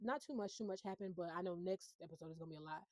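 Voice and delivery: usual speaking voice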